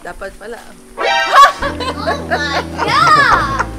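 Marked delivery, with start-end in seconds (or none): 1.6-3.7 slow, drawn-out amazed cry with laughter; amazed reaction. Real, not acted. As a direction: gasp it out, stretched and laughing. Reacting to the finished reveal.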